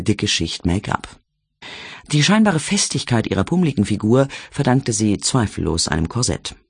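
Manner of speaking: plain reading voice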